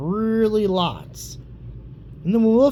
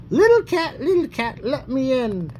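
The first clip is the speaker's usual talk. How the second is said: wolf at the door; 6 semitones higher than usual. Acted. Wolf voiced in read-aloud story.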